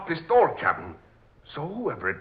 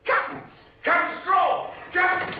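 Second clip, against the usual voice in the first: much higher, loud terrified calls; panicked shout; 4 dB louder than usual. Acted. Sailor fleeing the skeleton, screaming for the captain. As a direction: yell for help in terror.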